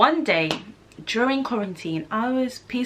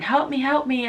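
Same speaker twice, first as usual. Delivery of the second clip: imitated help me; 6 semitones higher, 3 dB louder than usual. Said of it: "I kept hearing".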